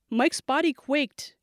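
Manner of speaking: plain reading voice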